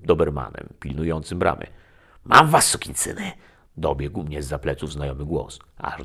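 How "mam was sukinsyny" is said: high, loud gloating shout; the devil shouting after the fleeing pair, high and loud; triumphant snarl. A narrator's reading. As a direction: yell it like a hunter catching prey. Pursuer shouts from behind.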